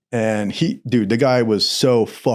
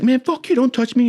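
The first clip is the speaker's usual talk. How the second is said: high-pitched mock indignation; indignant high retort; defensive protest; 11 semitones higher than usual. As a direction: imitate a guy pushing back in a scuffle. Bunkmate voiced in a scuffle.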